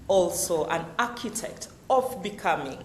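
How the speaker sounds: normal talking voice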